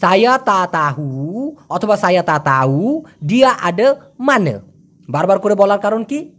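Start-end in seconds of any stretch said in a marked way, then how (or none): 0.0-1.5 slow "সায়া তা তাহু", no k, long rising end; colloquial pronunciation. Shown as a demonstration. Teacher assembling the phrase, wavy pronunciation. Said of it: "মালয়েশিয়ানরাও তাই বলে". 2.0-4.6 "তা তাউ" with no k or h, "আডে মানে" ending in schwa; colloquial pronunciation. Shown as a demonstration. Teacher giving the whole spoken sentence, wavy pronunciation. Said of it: "মালয়েশিয়ানরাও তাই বলে".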